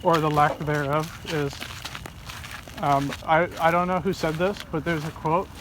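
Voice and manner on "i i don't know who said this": ordinary delivery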